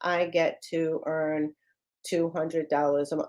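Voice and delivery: just sitting there going through the motions; deflated, no belief in the words; flat and unconvinced. Shown as a demonstration. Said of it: "don't just sit there going".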